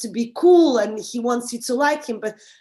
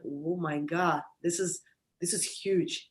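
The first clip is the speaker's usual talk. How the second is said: awed realization; 4 semitones lower, 7 dB quieter than usual. Retold or imitated. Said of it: "I felt the, like".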